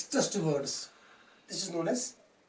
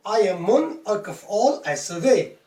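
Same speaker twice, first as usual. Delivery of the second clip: "I am monarch of all I survey" with MON, ALL and VEY punched louder; stress every third syllable; 4 dB louder than usual. Shown as a demonstration. Teacher showing rhythm to students, loud stress on every third syllable of "I am monarch of all I survey". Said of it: "read this line like this".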